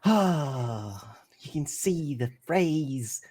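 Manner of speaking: walrus poet. Acted. Player voices walrus poet greeting morning.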